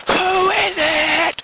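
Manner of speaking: very high, long drawn-out yell; shouting. Retold or imitated. You shout downstairs after no answer, slow high yell. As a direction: stretch each word in a high call. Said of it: "You'll shout and going".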